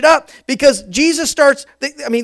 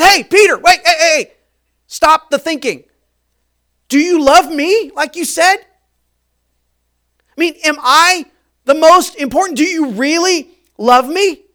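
loud, higher call cutting him off; sharp snap; 5 semitones higher, 5 dB louder than usual. Acted. Jesus snaps at a brooding Peter, loud call. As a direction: snap him out of his thoughts. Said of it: "Jesus snaps and says".

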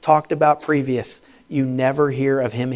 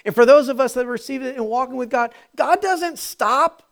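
loud, high, driving preacher voice; fervent preaching; 10 semitones higher, 6 dB louder than usual. Acted. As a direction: preach it out to the crowd with fire. Preaching at full force.

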